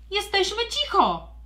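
loud, high shouted retort; booming reply. Acted. Trelka booming back at the neighbour. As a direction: boom it back defiantly. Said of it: "Zagrzmiała tubalnym głosem Trelka".

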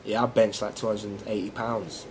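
low, hushed show-off voice; low-key bragging. Acted. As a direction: brag softly and deep to impress a girl. Acted boast to a girl.

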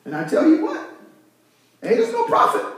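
squeaky-high mimicry, tickled; Mother Ada. Church mother confessing to her pastor, comically high imitation. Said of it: "She says".

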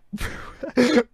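high, sudden burst of laughter. Real, not acted. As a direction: crack up at the memory. Laughs at own retold predicament.

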